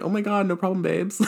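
high, gushy mock sweetness; hammed-up friendly gush ending in laughter; gushing reply. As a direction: play up a breezy, high-pitched "no problem". Acted gushing reply to thanks.

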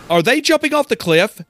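high, loud disbelief; can't believe they jumped; surprised. Real, not acted. Gamer watching cutscene, shocked and loud.

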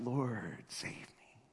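hushed, breathy plea; quiet desperate plea; retold cry for help. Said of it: "he just cries out".

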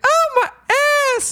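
very high-pitched comic yelp of pain; hammed-up, piercing scream; mock scream. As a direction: shriek it like getting hit in a slapstick scene. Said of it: "he went back to the screaming well".

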